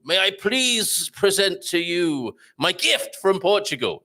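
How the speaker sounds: slow, high, put-on Portuguese accent; a courtier's hammed-up Portuguese accent. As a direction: present the gift in a mock Portuguese accent. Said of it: "in a Portuguese accent".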